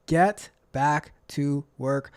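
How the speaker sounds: slow, word-by-word order; annoying voice. Acted. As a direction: spell out the nag, one slow word at a time. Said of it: "my annoying voice comes saying".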